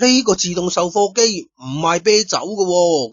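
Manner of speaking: plain reading voice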